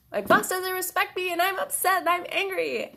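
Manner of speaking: put-on high, loud little-kid voice, upset; inner child voice. Shown as a demonstration. Therapist voicing her inner child, a high, upset little kid. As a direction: speak as an upset five-year-old, high and loud. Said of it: "having your five-year-old voice".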